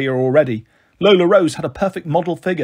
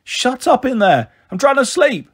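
angry call; 9 semitones higher, 3 dB louder than usual. A narrator's reading. Stranger next door trying to sleep, angry call. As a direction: call out crossly, pitched well up. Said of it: "someone called".

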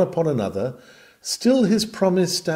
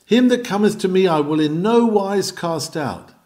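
ringing, raised preacher's proclamation; preaching the text; 5 semitones higher, 3 dB louder than usual. Acted. Preacher proclaiming the promise to sinners, ringing. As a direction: proclaim the promise high and loud.

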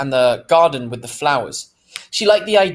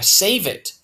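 ordinary delivery

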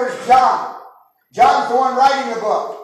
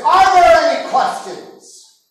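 slow, higher and louder, a challenge not a question; demanding challenge; 5 semitones higher, 5 dB louder, slower than usual. Retold or imitated. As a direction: dare the crowd to answer. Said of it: "He demanded, not really asked".

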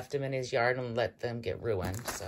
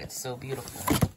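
ordinary delivery

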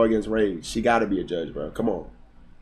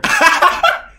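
sudden high airy laugh; high burst of laughter; 7 dB louder than usual. Real, not acted. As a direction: laugh out loud at the chat. Reacting to chat message.

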